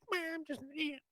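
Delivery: high, thin ant voice; tiny ant voice. Pretend. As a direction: squeak it like a small ant. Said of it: "and they say".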